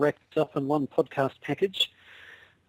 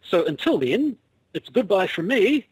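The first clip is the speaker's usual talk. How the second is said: raised, louder mock-formal sign-off; Two Ronnies sign-off; 6 semitones higher, 4 dB louder than usual. Co-host ending the podcast with a comedy-show farewell, mock-formal. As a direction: sign off like an old TV comedy double act. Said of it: "You were expecting a Two Ronnies reference".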